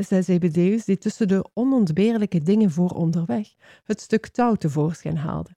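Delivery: plain reading voice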